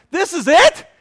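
high, loud, rising disbelief; incredulous outburst. Retold or imitated. Preacher replays his complaint to his counselor, loudly incredulous. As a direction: nearly shout the question, appalled.

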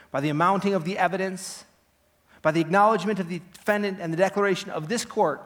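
prosecutor's closing speech. Acted. Prosecutor resting his case against the accused, forceful accusing address.

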